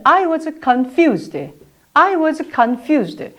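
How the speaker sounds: slow, careful I was confused; slow model sentence. Shown as a demonstration. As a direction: say it slowly with a clean f. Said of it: "자, 보겠습니다".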